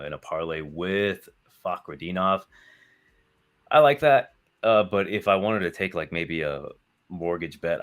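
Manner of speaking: usual speaking voice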